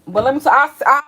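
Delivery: real yell that opens the point; loud outburst. Real, not acted. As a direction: blast it out, then settle. Shouts to open new point.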